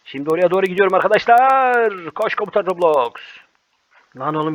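normal talking voice

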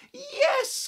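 high, loud joyful shout; excited cry. Acted. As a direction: call out a happy yes. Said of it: "cried the witch".

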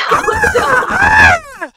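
very high, loud creature shriek; loud dinosaur screech. Acted. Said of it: "as loud as he can".

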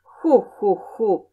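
acted hooting giggle; little imp's spelt-out laugh; mocking laughter. A narrator's reading. Imp under boy's collar laughs at him, gleeful hooting. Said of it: "zaśmiewa się Cudaczek".

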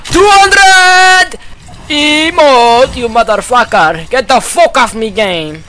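furious yell. Real, not acted. Raging at enemy in firefight.

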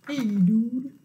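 low, drawn fish voice; deep, casual fish greeting; fish character. Acted. Fish's line repeated in character.

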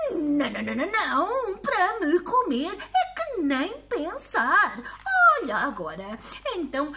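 put-on high, fussing old lady; indignant old woman. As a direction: protest like a flustered granny.

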